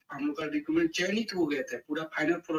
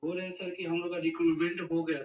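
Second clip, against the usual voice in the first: raised from barely audible to clearly audible; speaking up; 6 dB quieter than usual. Real, not acted. Caller restarting his question after being asked, speaking up. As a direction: speak up so the host can hear. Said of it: "जोर से बोलो".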